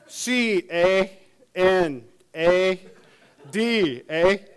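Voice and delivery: each letter drawn out, laughing; mock Canadian spelling of Canada, with a laugh; spelled out slowly.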